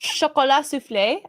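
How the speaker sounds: "Chocolat" as "sho-ko-LA"; French pronunciation. Shown as a demonstration. Co-host reveals proper French, "Chocolat" with silent T. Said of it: "the French say chocolat".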